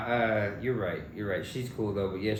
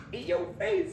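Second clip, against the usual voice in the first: taunting. Real, not acted. Taunting after laugh.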